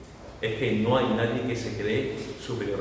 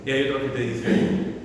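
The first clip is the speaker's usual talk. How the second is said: ordinary talk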